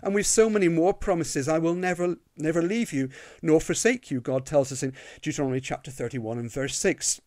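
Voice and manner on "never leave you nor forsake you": normal talking voice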